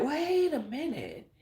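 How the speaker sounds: dawning suspicion. Retold or imitated. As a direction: realise she's coming for you. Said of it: "I was like".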